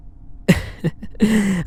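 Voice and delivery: light high chuckle at his own joke; a brief teasing laugh; amused chuckle. Acted. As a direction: laugh it off playfully. Teasing captive, laughs at own line.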